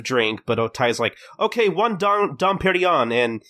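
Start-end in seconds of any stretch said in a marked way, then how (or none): 1.4-3.1 high, bright-eyed order call; chirpy hostess. Retold or imitated. Hostess calls in the expensive drink, chirpy. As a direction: imitate a hostess ignoring what was ordered.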